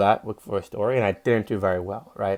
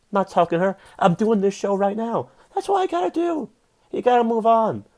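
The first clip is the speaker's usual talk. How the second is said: higher and higher, fired up; rising pep talk; 8 semitones higher than usual. Real, not acted. Comic rallying listeners, building to a fired-up pitch. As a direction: pump the crowd up, building.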